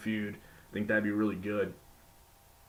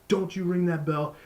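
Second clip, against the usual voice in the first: firm, commanding referee, raised and higher; emphatic referee; 6 semitones higher, 4 dB louder than usual. Retold or imitated. Referee ordering the bell ringer to wait, emphatic and commanding. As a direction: lay down the law like a ref scolding the bell ringer. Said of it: "very emphatic".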